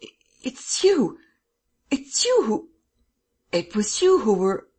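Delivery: very high, halting, stunned stammer. A narrator's reading. Man recognises his former attacker, stammering in amazement. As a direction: stumble over the words in amazement. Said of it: "he stammered".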